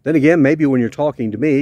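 ordinary delivery

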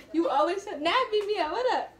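usual speaking voice

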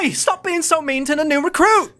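high, loud telling-off; scolding sister. Acted. Kotul telling off her sister, scolding. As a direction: rebuke her with an indignant yell. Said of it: "slaps her sister".

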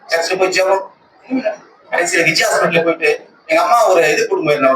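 ordinary delivery

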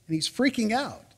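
ordinary delivery

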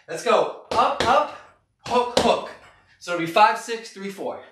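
normal talking voice

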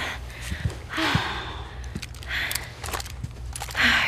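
long unvoiced exhale; weary sigh. Sighing before heading back out. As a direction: let out a tired sigh.

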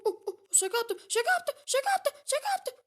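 high, loud frantic yelps; frantic gamer squeal, rising; panicked yelling. Real, not acted. Panicked taunt in game.

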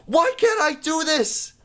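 very high, whiny frustration; exasperated squeal. Real, not acted. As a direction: squeal in disbelief at failing. Keeps failing the obstacle course.

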